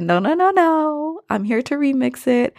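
stepping-in protest. Retold or imitated. Voicing Billy Ray stepping in.

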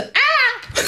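high hammed-up scream; fake fall scream. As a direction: shriek like a bad stunt fall. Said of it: "ich so".

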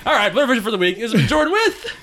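ordinary talk

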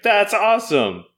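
high excited cheer. Acted. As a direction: burst out with excitement. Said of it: "are like".

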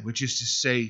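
ordinary talk

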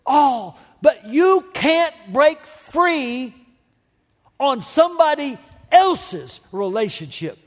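full-throated sermon climax; preaching at full force. Acted. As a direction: drive it home like a revival preacher.

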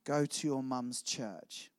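slow, low, gentle voice. Retold or imitated. God speaking to a lost young man, very gently. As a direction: say it slowly and softly, a tender prompting. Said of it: "very gently".

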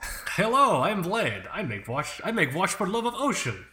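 mock foreign accent with swooping pitch, as Vlad. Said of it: "I did my Vlad accent".

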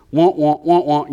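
loud wah-wah imitation of droning talk; mock drill sergeant. Father mocking drill sergeants talking at his daughter, loud droning. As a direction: mimic meaningless yammering, loudly. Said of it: "whenever they're talking at you".

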